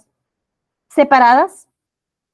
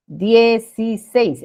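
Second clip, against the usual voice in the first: Dieciséis slow, in separated syllables, stress on SÉIS; sílaba tónica marcada; 2 semitones higher, 3 dB louder, much slower than usual. Shown as a demonstration. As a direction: sound out Dieciséis slowly for a class. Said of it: "Ahí está la sílaba tónica". (Dieciséis lento, por sílabas, marcando SÉIS)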